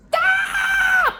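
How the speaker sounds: real exasperated yell; shouted stop. Real, not acted. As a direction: yell it to cut the noise off. Begging the endless mix to end.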